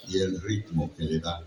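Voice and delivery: ordinary delivery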